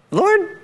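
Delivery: "Lord" jumping high like a question. Shown as a demonstration. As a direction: ask "Lord?" in a high, uncertain pitch. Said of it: "I'm gonna say it as, like, a, a question".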